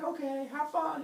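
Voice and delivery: high, sing-song wife voice; mock-sweet send-off in a high voice; chirpy wife. Teller as wife seeing Heracles off, high chirpy voice.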